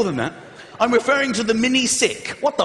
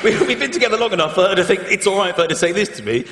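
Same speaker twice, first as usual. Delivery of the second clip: usual speaking voice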